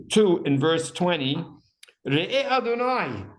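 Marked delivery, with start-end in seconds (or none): 2.1-3.2 insistent plea. Acted. She keeps telling God to look, insistent plea. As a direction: call upward to God, pitched high. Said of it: "she keeps telling God to look".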